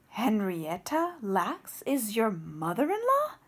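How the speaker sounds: slow, amazed question; suddenly excited. Acted. Scientist learns who her mother-in-law is, slow excited disbelief. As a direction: let the realization land slowly. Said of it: "suddenly excited".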